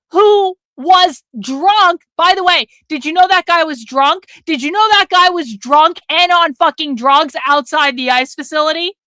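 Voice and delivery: normal talking voice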